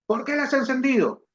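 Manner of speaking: a mother catching the TV on; mom scolding. Retold or imitated. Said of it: "mother questioning son". (mamá regañando al ver la tele prendida, con voz más aguda)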